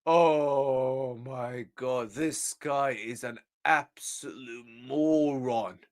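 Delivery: very slow, hushed, drawn-out groan of despair; real weary exasperation, barely voiced; exasperated disbelief. Real, not acted. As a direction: stretch every word out low and soft in disbelief. Despairing at the manager's remark.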